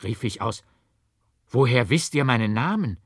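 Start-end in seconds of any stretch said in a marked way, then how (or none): 1.5-3.0 louder, blurting the question out; alarmed, vehement outburst; vehement cry. A narrator's reading. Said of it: "Die Heftigkeit, womit ich diese Worte ausstieß".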